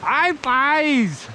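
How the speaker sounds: high, ecstatic yelling over a catch; thrilled, shouting with joy; excited shouting. Real, not acted. Landing a big fish.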